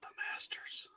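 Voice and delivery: hushed golf announcer whisper. Pretend. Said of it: "I had to use my golf voice".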